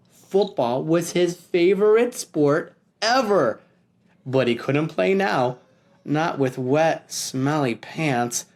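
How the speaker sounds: usual speaking voice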